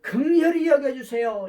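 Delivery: 긍휼히 said loud and falling; loud plea. Shown as a demonstration. Preacher models the prayer to his flock, loud plea. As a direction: cry for mercy. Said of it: "뭐냐니까".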